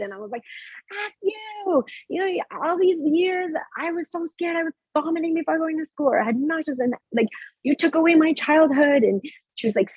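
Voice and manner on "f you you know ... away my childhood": high-pitched mock yell at an old bully; retold angry outburst. Woman in hypnotherapy telling off her childhood bully, high-pitched retold yell. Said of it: "first screaming".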